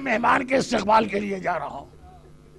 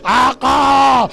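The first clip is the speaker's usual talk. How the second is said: very slow, much louder wailing of the word; anguished cry; 6 dB louder, much slower than usual. Retold or imitated. Preacher voicing Hur's last cry to his master, stretched loud wail. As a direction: draw each word out as a loud grieving wail. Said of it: "ایک جملہ میں آخری کہتا ہوں".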